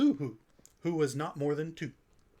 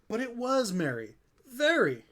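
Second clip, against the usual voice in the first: amazed; 6 semitones higher, 4 dB louder than usual. A narrator's reading. Narrator marvels at merry sound.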